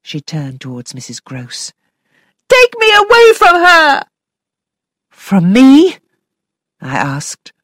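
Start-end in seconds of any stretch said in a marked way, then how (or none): 2.5-4.0 put-on high, loud childish defiance; spiteful little girl. A narrator's reading. Flora rejecting the governess, high, loud and spiteful. 5.1-6.0 loud, high startled question; shocked. A narrator's reading. Governess stunned by Flora's words, loud and high.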